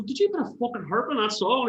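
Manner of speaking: high, disbelieving question; incredulous. Retold or imitated. Asking the bandmate about the harp, incredulous and high. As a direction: squeal it in disbelief.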